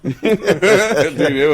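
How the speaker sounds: slow drawn-out laughing line. Real, not acted. Telling co-hosts about the old followers, laughing. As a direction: laugh it out as you admit it.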